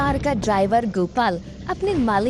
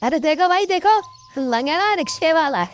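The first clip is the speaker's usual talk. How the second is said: mocking bystander; 5 semitones higher than usual. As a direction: jeer at the cripple for the crowd. Bystander mocks lame man.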